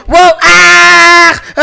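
a long, drawn-out shout as he falls; falling shout. Real, not acted. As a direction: yell slowly and loudly, losing his footing. Falling off the parkour. (صرخة طويلة وهو يقع)

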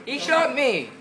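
very high, loud squawk; outraged yelling. Pretend. Gamer shot by an enemy, high loud outraged cry. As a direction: yell in high-pitched outrage.

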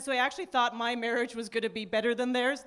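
ordinary talk, nothing marked

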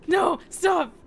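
high, loud startled shouts; alarmed cry. Real, not acted. Startled by the darkness.

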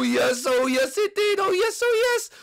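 excited cheer climbing high; gleeful celebration. Acted. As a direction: let the delight shoot upward. Mario finds the game included.

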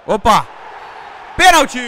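high, loud surprised yell; excited shout. Real, not acted. Penalty called.

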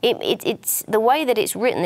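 ordinary delivery

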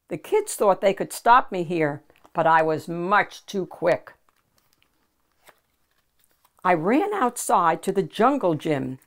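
ordinary delivery

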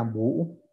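bu held long with the u broken and repeated; long rearticulated u. Shown as a demonstration. Teacher demonstrating the long u in bu, careful demo. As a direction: draw out bu, re-sounding the u. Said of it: "La U larga rearticulada sería".

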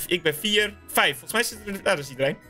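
ordinary talk

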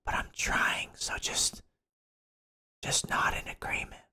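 hushed, conspiratorial whisper; soft whispered aside. Pretend. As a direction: whisper a little plea to play along. Asking chat to humour the accent.

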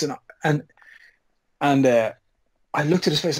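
usual speaking voice

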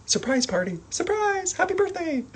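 high-pitched party cheer; bright squeal of surprise; excited surprise. Shown as a demonstration. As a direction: shout surprise at the birthday.

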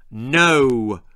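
loud, short shout. Acted. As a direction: cut her off with a firm shout. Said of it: "shouted Angela's mother".

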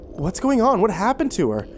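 high, urgent questioning; insistent. A narrator's reading. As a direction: demand answers, pitch jumping up. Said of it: "she insisted".

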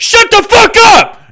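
high screaming yell; hammed-up furious scream; mock rage. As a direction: explode at him for a joke.

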